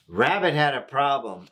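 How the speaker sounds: ordinary delivery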